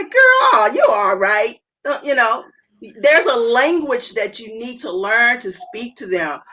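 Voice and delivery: hammed-up sassy friend cheering her up; dismissive hype friend. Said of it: "Those are not the things you wanna say".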